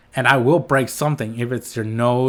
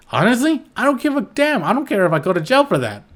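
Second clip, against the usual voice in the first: worked-up outburst; 9 semitones higher, faster than usual. Real, not acted. To abusive parents, a high defiant rush. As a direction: pitch jumps way up, then rushes fast. Heated rant about defending children.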